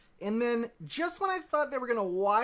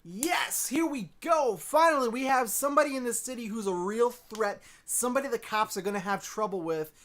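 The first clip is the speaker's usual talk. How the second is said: excited; 5 semitones higher than usual. Retold or imitated. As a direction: cheer like the show finally delivered. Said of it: "And I was like".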